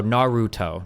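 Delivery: NARUTO with a stretched, stressed RU; English-style Naruto, leaning on the middle syllable; stress on second-to-last syllable. Shown as a demonstration. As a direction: lean hard on the "ru" in Naruto. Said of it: "accent from the second to last syllable".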